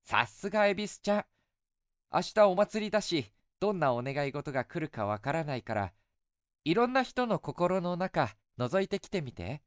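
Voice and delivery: put-on light, coaxing young woman; goddess voice. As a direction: sweetly tease an old friend. Goddess speaks.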